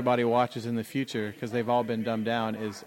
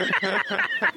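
Cajun accent; 3 dB louder than usual. Shown as a demonstration. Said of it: "Do it".

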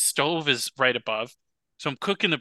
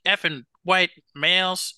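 imitated yell; 5 semitones higher than usual. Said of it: "They yelled, like".

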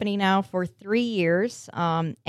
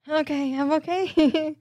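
laughing; 8 semitones higher than usual. Real, not acted. Recovering from laughing fit.

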